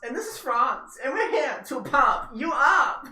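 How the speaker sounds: high, laughing mock Austrian bodybuilder. Stripper character introduces himself to the crowd, mock Austrian. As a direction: pump them up, Schwarzenegger style, hammed. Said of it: "Sounds like Arnold Schwarzenegger".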